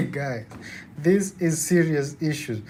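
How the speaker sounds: ordinary talk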